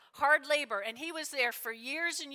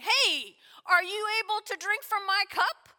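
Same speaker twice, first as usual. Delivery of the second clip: higher, louder, brisk question; calling out a challenge; 7 semitones higher, 5 dB louder than usual. Acted. Jesus challenging the two brothers, loudly calling out. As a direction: throw it out as an eager dare. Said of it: "So he's going".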